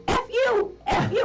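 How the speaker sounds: high-pitched squawked bird imitation; cussing parrot. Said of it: "And the bird says".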